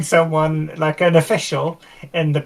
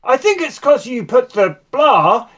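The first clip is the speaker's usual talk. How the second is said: lightly voiced tinkerer, pitched higher; higher-pitched, gently explaining character voice; eager helpful mechanic; 7 semitones higher than usual. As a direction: offer earnest technical advice in a higher voice. Player voices Spanners giving mechanical advice.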